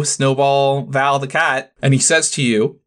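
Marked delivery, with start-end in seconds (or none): none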